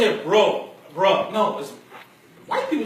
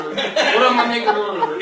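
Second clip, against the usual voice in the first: Tyler Perry voice; 5 dB louder, much slower than usual. Shown as a demonstration. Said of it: "you now sound like Tyler Perry".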